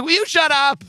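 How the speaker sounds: high-pitched imitation of an exasperated kid; mock exasperation. Friend answering back his mom, mock exasperated. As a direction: squeal it as a comic telling-off.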